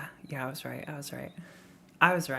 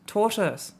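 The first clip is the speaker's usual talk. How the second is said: loud frustrated tortoise; 5 semitones higher, 6 dB louder than usual. Shown as a demonstration. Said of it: "I'm not saying it right".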